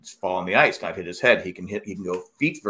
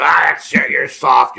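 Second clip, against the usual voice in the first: mocking macho scoff; 4 semitones higher, 9 dB louder, much slower than usual. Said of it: "People always go".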